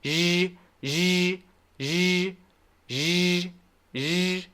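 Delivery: repeated drawn-out "zh", voice humming through it; voiced SH. Shown as a demonstration. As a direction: hold "zh", sh with voice added. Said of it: "put them together".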